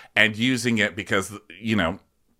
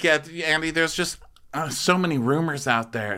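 lightly imitated higher voice; higher-pitched retold answer, only lightly put on; mock housewife; 7 semitones higher than usual. Answering Andy about the rumors, lightly mimicked high voice. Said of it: "And she's like".

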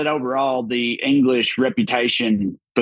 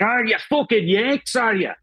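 higher, excited put-on Scottish lass voice; mock Scottish lassie; 10 semitones higher than usual. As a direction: squeal it like a wild Scottish girl who just heard Yanks. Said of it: "this fire-haired Scottish lassie".

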